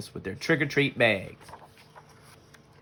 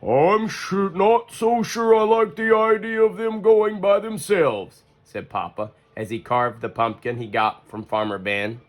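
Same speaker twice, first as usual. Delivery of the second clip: a bit higher, slower, lightly voiced worried father; Papa Bear voice; 5 semitones higher, slower than usual. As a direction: play the uneasy dad.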